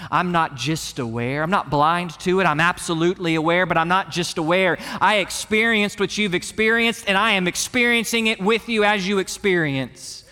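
rising, driving pulpit delivery; impassioned preaching. Acted. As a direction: preach it with rising intensity as Jesus speaking. Preaches in Jesus' voice, building.

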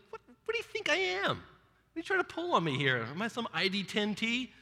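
high, incredulous mock outrage; exasperated Samuel. Samuel scolding Saul, mock offended and high. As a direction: play Samuel, annoyed at being taken for a fool. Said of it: "it's almost like Samuel's like".

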